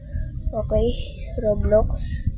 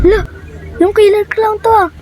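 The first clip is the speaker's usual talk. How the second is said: panicked; 8 semitones higher than usual. Real, not acted. Clown appears, panicked shout.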